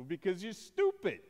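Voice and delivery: high, loud imitation of a scornful father; hammed-up dad delivering an insult; mocking put-down.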